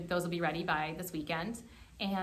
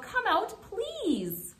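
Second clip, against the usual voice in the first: high-pitched pleading; imploring storybook mayor; begging; 12 semitones higher, 3 dB louder, much slower than usual. A narrator's reading. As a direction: beg with a long, high "please". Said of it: "begged the groundhog".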